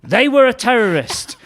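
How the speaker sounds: high, loud mock-outraged headline; alarmist tabloid cry. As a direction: proclaim it like a scandal sheet.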